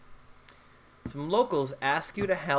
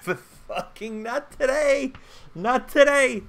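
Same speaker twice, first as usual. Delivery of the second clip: villain taunt; 11 semitones higher, 4 dB louder than usual. Acted. Gleeful taunt at the big bad.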